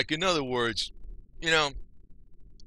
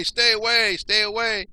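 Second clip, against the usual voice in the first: louder, higher, pushing them off; loud warning; 8 semitones higher, 8 dB louder than usual. Real, not acted. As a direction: shout it to keep them away. Telling Yankees to stay away.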